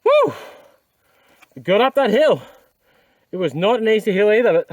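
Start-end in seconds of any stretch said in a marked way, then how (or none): 0.0-0.3 high-pitched "woo!" of relief; triumphant whoop. Real, not acted. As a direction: let out a high victory whoop. Relieved cheer after getting up hill.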